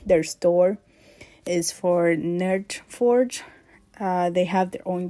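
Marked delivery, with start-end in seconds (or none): none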